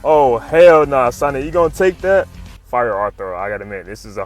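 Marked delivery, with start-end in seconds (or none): none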